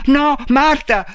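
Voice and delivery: loud high plea; panicked cry. A narrator's reading. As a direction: scream her name in terror. Robot cries as she strikes. (urlo di panico)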